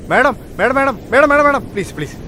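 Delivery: high, urgent repeated calls; frantic pleading. Acted. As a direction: beg her to stop and listen. Pleads with the offended madam. (പരിഭ്രമത്തോടെ ഉയർന്ന സ്വരത്തിലുള്ള അപേക്ഷ)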